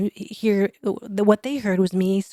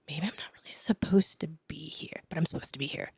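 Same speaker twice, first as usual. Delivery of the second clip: hushed, unsure whisper to yourself; doubtful whisper; 3 semitones lower, 7 dB quieter, faster than usual. Retold or imitated. Doubting herself on the table, soft whisper. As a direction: talk yourself into it under your breath.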